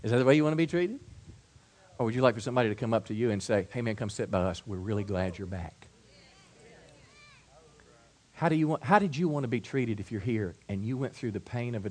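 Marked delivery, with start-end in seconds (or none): 3.7-5.9 soft, kindly invitation, much quieter; a softly voiced friendly welcome; gentle welcome. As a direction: draw him in gently.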